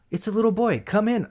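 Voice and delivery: high-pitched mock gushing welcome; imitated cooing wife. Wife inviting a little boy in, mock high cooing.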